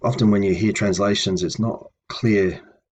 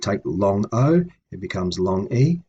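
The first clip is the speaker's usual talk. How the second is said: usual speaking voice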